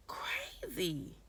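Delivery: very soft, whispered "crazy"; whispered disbelief. Real, not acted. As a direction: repeat it under your breath, exasperated. Repeats it under her breath.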